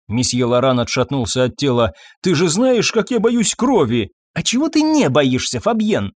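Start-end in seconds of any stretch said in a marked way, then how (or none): 2.2-4.1 high, fearful whine; squeamish protest. A narrator's reading. Husband recoils from the corpse, squeamish. 4.3-6.1 scornful retort. A narrator's reading. Wife taunts fearful husband.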